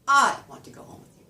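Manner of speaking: loud high "I" with the rest tailing off; stress on "I". Shown as a demonstration. Answering who wants to go, stressed "I". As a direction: hit "I" hard and first. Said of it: "Who wants to go home".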